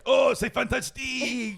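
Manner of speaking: high, slow, gushing French; mock French ad voice. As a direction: sell it like an overexcited car ad. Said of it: "they're all like".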